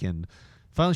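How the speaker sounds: ordinary talk, nothing marked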